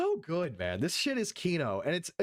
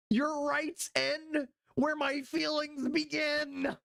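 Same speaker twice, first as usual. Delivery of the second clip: high, slow, mocking whine; mock whiny activist; 11 semitones higher, much slower than usual. Mocking the convention speakers in a high whiny voice. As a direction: sneer it out in a put-on high voice.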